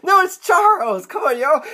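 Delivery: high, whiny pleading; acted pained protest. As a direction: object to the teasing with pitch way up. Protests the teasing on the ground.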